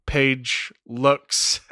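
ordinary talk

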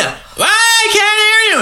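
very high yell over a blender; high-pitched, hammed-up holler; shouting over noise. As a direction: yell as if you can't hear. Julie yelling over the blender.

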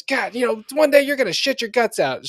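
high mock-scolding wife. Wife scolding husband about farting, high voice. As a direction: scold him in a high put-on voice.

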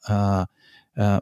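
usual speaking voice

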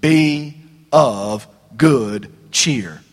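very slow, stretched "Be of good cheer"; Jesus calming frightened disciples, slow and deliberate; drawn-out reassurance. Acted. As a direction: linger on every word of "Be of good cheer". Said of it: "the first thing he said to them was".

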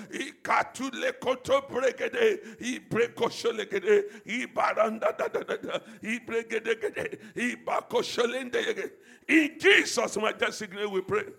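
loud, slowed fervent prayer; preaching at full force. Acted. Preacher praying over the congregation, loud and fervent.

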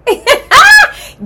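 a high, loud burst of laughter; real high laughing squeal. Real, not acted. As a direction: let a giggly squeal break out.

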